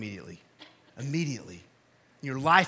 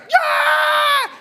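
high, loud cheering yell; crowd cheer; 12 dB louder than usual. Retold or imitated. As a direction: cheer like a wild crowd of kids. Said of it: "everybody's like".

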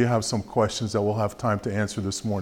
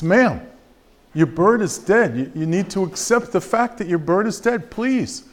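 exasperated vet; 7 semitones higher than usual. Acted. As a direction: lose patience, beg her to accept it. Vet, exasperated, repeats the news.

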